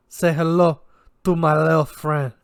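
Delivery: put-on hysterical Cubano accent, high and loud; over-the-top Cuban accent, voice cracking. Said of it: "switched to his best Cubano accent, his voice cracking hysterically".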